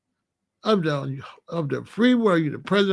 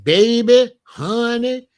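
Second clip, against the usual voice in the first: sweet-talking mock; 4 semitones higher, 5 dB louder, slower than usual. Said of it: "She wasn't like".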